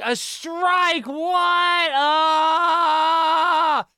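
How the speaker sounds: high startled yelp; shocked shout. Retold or imitated. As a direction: react like you just got blindsided. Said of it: "And I'm like".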